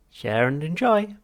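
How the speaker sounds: high, slow, loud cheery machine voice; chirpy machine. Acted. Drink machine chirping its motto at Arthur, cheery machine voice. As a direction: sing it out like a relentlessly cheerful vending machine.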